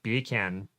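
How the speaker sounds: "Beer can" as "bee-can", Jamaican accent. Shown as a demonstration. Demonstrating a school trick, Jamaican-accented "Beer can". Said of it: "beer can with a Jamaican accent".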